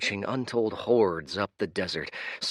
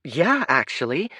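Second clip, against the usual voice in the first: cheerful, higher Kaya voice; bright Kaya; 7 semitones higher, 5 dB louder than usual. Acted. Kaya answering the street kid, bright and cheerful. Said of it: "said Kaya brightly".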